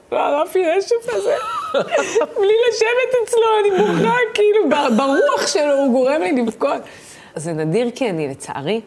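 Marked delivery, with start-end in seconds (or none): 0.1-6.8 very high, loud mock crying; wailing imitation through laughter; hammed-up bawling. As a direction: reenact bursting into tears, comically overdone. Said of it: "אני פורצת בבכי".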